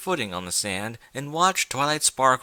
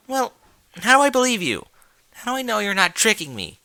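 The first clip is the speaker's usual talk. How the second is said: much higher and louder, furious mare; angry; 11 semitones higher, 6 dB louder, faster than usual. Acted. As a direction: demand answers in a shrill burst of rage. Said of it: "said Twilight angrily".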